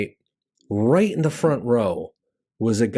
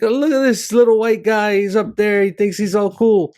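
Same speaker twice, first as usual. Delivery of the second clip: high, louder teasing voice of the audience; mocking crowd; 8 semitones higher, 4 dB louder than usual. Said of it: "They're like".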